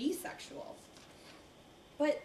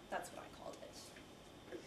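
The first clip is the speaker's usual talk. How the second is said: whispered; 16 dB quieter than usual. Acted. Confiding aside.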